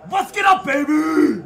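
cocky jubilant cry; triumphant yell. Real, not acted. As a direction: holler it in celebration. Celebrating another goal.

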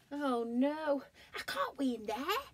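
high, loud dismayed protest; dismayed bear. Acted. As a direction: recoil in horror. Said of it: "said Alan".

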